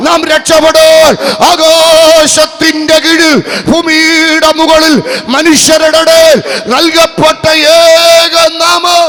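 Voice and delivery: high, loud, slow fiery sermon shout; shouted preaching. Real, not acted. Preacher warning the crowd of danger, full-force shouted sermon. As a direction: thunder it out to warn the crowd. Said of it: "അലറിക്കൊണ്ട് പറയുക".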